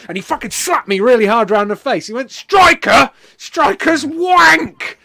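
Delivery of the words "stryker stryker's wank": high, full-throated scornful yell; outraged shout. Retold or imitated. Wrestler slapping the man over his favourite character, shouting in outrage. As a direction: scream it in disgust, stretching the last word.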